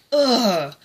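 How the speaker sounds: loud "ugh" of exasperation; exasperated, forceful groan; frustrated groan. Real, not acted. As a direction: groan loudly, fed up.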